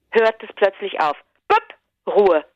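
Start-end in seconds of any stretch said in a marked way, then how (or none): 1.5-1.6 imitated engine cut-off. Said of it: "dieses Geräusch so gut machen".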